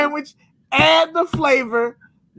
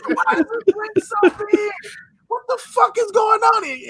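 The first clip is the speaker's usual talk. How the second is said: mock outrage; 6 semitones higher than usual. Outrage at huge drink.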